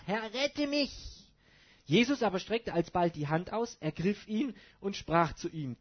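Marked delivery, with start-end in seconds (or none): 0.1-0.9 high, louder plea, a touch of panic; sinking Peter cries to the Lord; crying out for help. Acted. Reading Peter sinking, mild panicked cry. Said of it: "schrie er und sprach".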